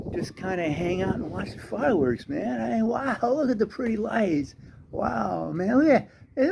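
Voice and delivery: high-pitched, dazed stoner wonder; spaced-out "wow, man" voice; stoner soldier. Acted. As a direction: gawk like you're blissfully stoned. Acts out stoned soldiers watching battle.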